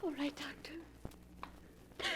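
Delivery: frightened whisper. Acted. Bride answers the doctor in a frightened whisper. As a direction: breathe it so no one hears.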